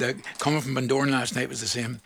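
ordinary delivery

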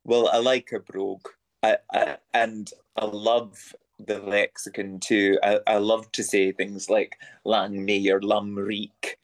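low, slowed Scottish brogue savouring each word; Scottish accent. Acted. Guest slips into Scottish while praising Scots words, Scottish accent. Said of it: "that Scottish accent is so absolutely spot on".

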